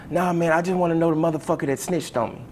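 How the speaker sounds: fast, high, agitated retort; upset with attitude. Retold or imitated. Student demands to know who snitched, upset with attitude. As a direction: act out an indignant kid, words rushing. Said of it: "with an attitude and upset".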